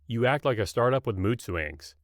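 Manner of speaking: ordinary delivery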